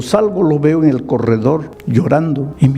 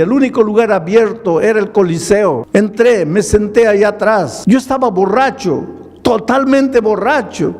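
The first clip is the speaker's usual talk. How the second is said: fervent testimony; 5 semitones higher than usual. Retold or imitated. Drunk man confessing to pastor, building to a fervent cry. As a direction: pour out the confession building in force.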